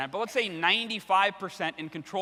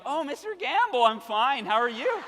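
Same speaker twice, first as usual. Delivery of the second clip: cracking puberty voice; 7 semitones higher than usual. Retold or imitated. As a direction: voice jumps up high, a teen mid-break. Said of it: "I'm going through puberty".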